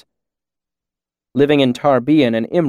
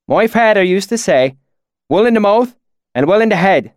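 higher-pitched imitation of rustic valley accent; put-on valley dialect; 8 semitones higher, 5 dB louder than usual. A narrator's reading. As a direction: slip into the swineherd's country dialect. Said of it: "my sudden change in dialect".

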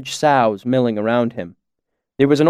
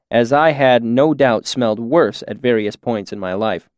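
usual speaking voice

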